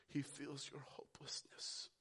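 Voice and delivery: gentle hushed reassurance; tender whisper. Real, not acted. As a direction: whisper comfort to the hurting.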